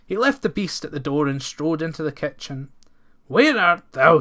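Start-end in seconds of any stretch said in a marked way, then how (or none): 3.3-4.2 loud call through the empty house; cried out. A narrator's reading. Lad calling through empty farmhouse, loud call. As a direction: call out loudly. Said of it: "he cried".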